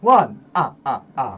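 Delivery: loud count with staccato mock laugh; cartoon counting character. Mock counting show with friend, loud theatrical count. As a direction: punch out the number, then chuckle theatrically. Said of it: "this has been Counting with [name]".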